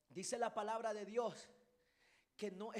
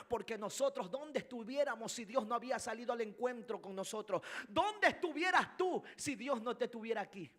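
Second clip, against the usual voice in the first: louder, insistent preaching; prédica enérgica; 4 semitones higher, 8 dB louder than usual. Real, not acted. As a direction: press the question on the congregation, raised voice. Prédica que sube de intensidad. (prédica enérgica en voz alta)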